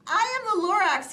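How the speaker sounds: high, loud proud announcement; Lorax voice. A narrator's reading. Lorax introduces himself.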